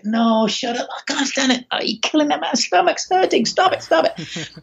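high-pitched helpless pleading; mock begging. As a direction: beg for mercy as if aching from laughter. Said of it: "until you beg and say".

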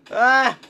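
sudden loud high yelp; startled scream. Real, not acted. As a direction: shriek in shock.

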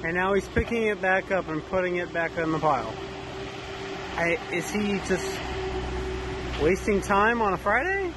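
high-pitched, exasperated disbelief; incredulous griping. Real, not acted. Vlogger watches a worker move dirt back and forth, incredulous.

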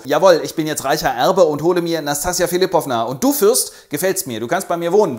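loud swaggering boast; boastful heir. Acted. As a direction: brag to the whole train. Rogoschin prahlt als reicher Erbe.

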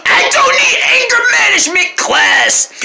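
high, loud crying shout; furious wailing denial; angry yell. Acted. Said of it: "you yell at everybody so much".

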